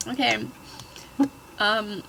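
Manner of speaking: usual speaking voice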